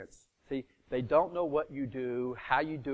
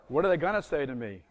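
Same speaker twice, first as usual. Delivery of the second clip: ordinary talk, nothing marked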